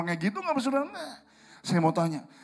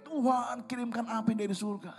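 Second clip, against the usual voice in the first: high but held-back mock cry; mock prayer shout; 6 semitones higher, 6 dB quieter than usual. Preacher showing what David did not do, mock shouted prayer. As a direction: imitate a dramatic plea without real volume. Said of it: "mengerang, teriak".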